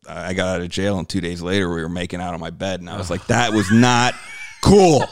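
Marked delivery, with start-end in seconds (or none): 3.3-5.0 slow, loud scold breaking into a laugh. Retold or imitated. Telling his ex it was not cool, loud and laughing.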